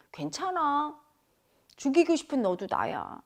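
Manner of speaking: calm the rage like a friend; gentle acceptance. Acted.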